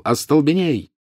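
high-pitched cry of an incantation; shouted spell. A narrator's reading. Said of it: "и крикнул". (высокий выкрик заклинания)

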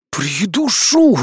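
slow, menacing hiss; angry hiss. A narrator's reading. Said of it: "зло прошипел демонёнок".

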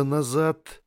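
high, urgent bark; barked order. Acted. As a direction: bark it out in panic. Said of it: "рявкнул Торн".

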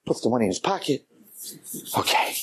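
ordinary delivery

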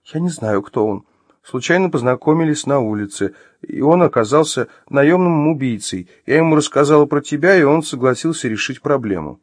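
high, hurried made-up explanation; hurried confession. Acted. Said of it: "затем быстро заговорила".

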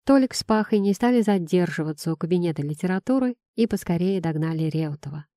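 usual speaking voice

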